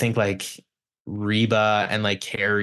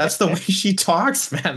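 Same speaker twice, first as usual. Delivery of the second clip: high, slow exasperated protest; retold exasperation; 9 semitones higher, 3 dB louder, slower than usual. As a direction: spell it out in mock exasperation. Retold exasperated reply to client.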